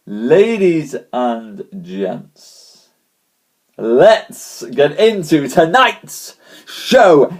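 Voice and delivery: very slow, drawn-out showman call; announcer call. Acted. Host launching tonight's show, slow showman call. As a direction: savour every word for the crowd.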